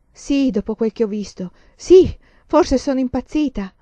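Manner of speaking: worn out, forcing the words out; rauca, sfinita. A narrator's reading. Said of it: "con una voce rauca per lo sfinimento". (voce rauca per lo sfinimento)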